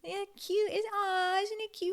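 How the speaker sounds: put-on high cooing child voice. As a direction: squeal over it like a kid. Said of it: "children voiced".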